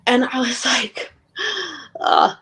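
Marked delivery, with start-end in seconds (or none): 1.3-2.0 sharp high gasp, caught off guard; a sudden stunned intake of breath. Retold or imitated. Retelling son's heartbreaking words. 2.0-2.4 low, louder pained ugh; a deep groan of heartbreak. Retold or imitated. Retelling son's heartbreaking words.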